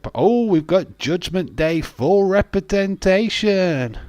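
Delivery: loud, high, drawn-out hype; excited announcing. Real, not acted. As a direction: proclaim the faction's arrival at full pitch. Hyping wrestlers arriving at ringside.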